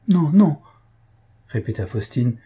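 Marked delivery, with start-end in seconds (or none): 0.1-0.6 high, loud distressed refusal; growing distress. A narrator's reading. Faustine struggles to hide her feelings from Mario, distressed. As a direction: protest in mounting distress. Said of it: "avec une détresse croissante".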